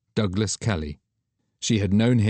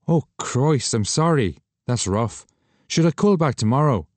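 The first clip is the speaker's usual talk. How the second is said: Douglas sympathetic; 6 semitones higher, 4 dB louder than usual. Acted.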